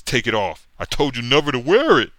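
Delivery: hammed-up stern command; angry hero. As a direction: order him to strip it off, angrily. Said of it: "And Cap says".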